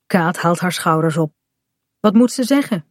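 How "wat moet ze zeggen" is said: ordinary delivery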